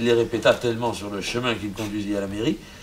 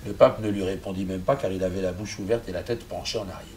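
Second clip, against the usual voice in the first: ordinary delivery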